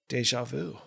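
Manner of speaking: soft, quiet aside after a pause; imitated punched man. Friend just punched in the jaw, imitated very softly. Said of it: "he literally goes".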